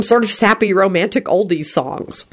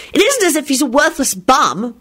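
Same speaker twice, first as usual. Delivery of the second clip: put-on indignant, high-pitched girl; 9 semitones higher than usual. A narrator's reading. Rosie defending the boy's work on potions, indignant. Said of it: "Rosie said indignantly".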